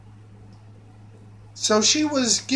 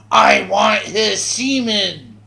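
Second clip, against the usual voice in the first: slow, louder creature hiss; hissing monster girl; 4 dB louder than usual. Retold or imitated. Brooke hissing at the narrator, slow loud creature voice. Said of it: "Brooke is hissing at me".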